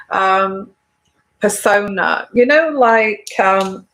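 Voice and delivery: ordinary talk, nothing marked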